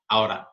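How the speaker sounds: "Out of" linked with a tapped T, like "outa"; flapped T. Shown as a demonstration. Teacher demonstrating the flat T in "Out of", linked like "outa". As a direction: join "Out of" and soften the T to a tap. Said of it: "esse T vai ter uma pronúncia mais chapada".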